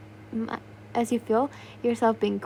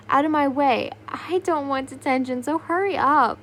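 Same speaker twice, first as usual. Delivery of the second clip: loud, pushy student shout; 4 semitones higher, 5 dB louder than usual. Acted. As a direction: shove through the crowd yelling. Students rush in late.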